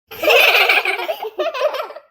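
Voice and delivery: loud burst of giggles; uncontrollable laughter. Acted. Friends alone after fooling Poomari, exploding into loud laughter. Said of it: "எனக்கு சிரிப்பு அடக்கவே முடியல".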